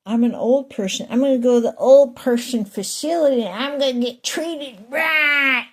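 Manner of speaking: lightly mock an old person in a commercial; a hint of a cheerful ad spokesman. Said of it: "advertisements for clinics".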